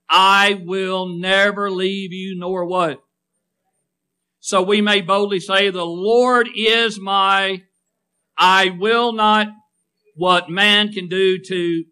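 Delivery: slow, loud lines left open for the crowd to finish; call and response. Shown as a demonstration. Preacher prompting the congregation to finish the verse, slow and loud. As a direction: draw the answer out of them. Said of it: "preacher leaves blanks for congregation".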